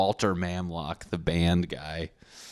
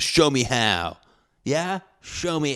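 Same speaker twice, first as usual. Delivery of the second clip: higher, a touch of nerdy bravado; adult nerd comeback; 6 semitones higher, 4 dB louder, slower than usual. Retold or imitated. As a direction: dare him like a nerd. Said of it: "in nerd fashion".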